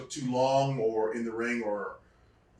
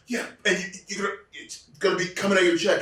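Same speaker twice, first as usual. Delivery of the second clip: stern agent; 7 semitones higher, 5 dB louder, faster than usual. Retold or imitated. Agent voiced in the story.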